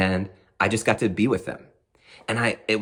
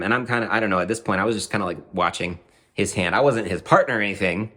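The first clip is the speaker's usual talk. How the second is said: ordinary talk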